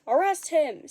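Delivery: loud, high scream for arrest; angry crowd scream. A narrator's reading. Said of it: "someone screamed".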